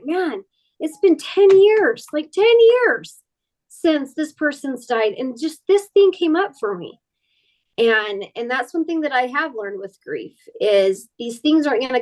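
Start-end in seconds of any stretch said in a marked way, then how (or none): 0.0-7.0 exasperated surprise. Retold or imitated. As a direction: marvel that it still hurts after years. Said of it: "that were just like".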